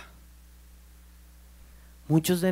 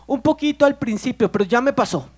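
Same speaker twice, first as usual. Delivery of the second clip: son's voice; 9 semitones higher, 8 dB louder than usual. Retold or imitated. Son answers father about crying.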